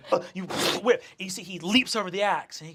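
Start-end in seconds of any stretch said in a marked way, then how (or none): none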